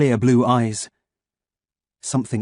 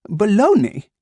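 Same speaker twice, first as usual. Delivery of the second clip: put-on "Baloney?" higher and loud, German accent, baffled; puzzled android; 10 semitones higher, 5 dB louder, slower than usual. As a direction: repeat baloney as an unknown word. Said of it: "as he sought a meaning".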